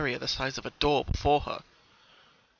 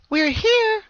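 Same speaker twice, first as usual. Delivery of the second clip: very high, loud happy call; called out happily; 10 dB louder than usual. Acted. Pinkamena calling to the forest, happy. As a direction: shout it gleefully into the woods. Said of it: "Pinkamena called out happily".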